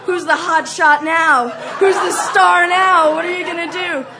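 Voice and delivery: high, loud gloating challenge; shouted taunting. Retold or imitated. Scarer jeering at the cornered star, loud taunting. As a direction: jeer at full voice. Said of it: "I was like".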